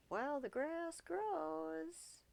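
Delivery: very slow, high, lingering phrase; drawn out. Acted. Hamlet drags the proverb out slowly to Rosencrantz. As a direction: stretch every word out.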